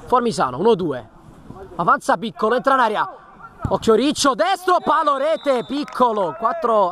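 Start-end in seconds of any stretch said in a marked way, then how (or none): 1.8-6.9 rising, breathless goal call; breathless italian goal commentary. Real, not acted. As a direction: build fast, then stretch the goal cry.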